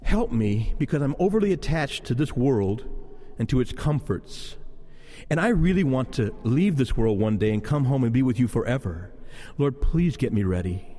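low, longing plea to God; hushed-down, yearning personal prayer; earnest prayer. Acted. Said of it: "when was the last time you and I really said".

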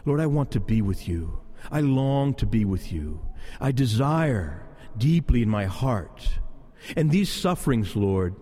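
low, longing plea to God; hushed-down, yearning personal prayer; earnest prayer. Acted. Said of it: "when was the last time you and I really said".

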